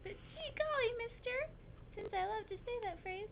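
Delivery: put-on high girlish voice; little girl character. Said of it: "the poor little girl".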